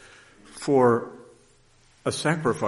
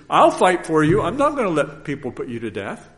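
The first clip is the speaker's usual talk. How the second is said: loud, higher fighting vow; defiant Peter; 5 semitones higher, 8 dB louder than usual. Acted. Peter promising to fight for Jesus, loud defiant vow. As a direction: declare it loudly, ready to fight.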